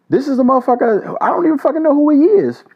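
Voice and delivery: sudden heated rant; worked-up outburst. Real, not acted. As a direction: erupt with disbelief about this stranger. Ranting about the stranger.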